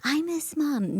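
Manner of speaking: high-pitched, drawn-out mock protest; retold motherly exasperation; exasperated correction. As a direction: set the child straight, stretching the words. Retold correcting her upset daughter.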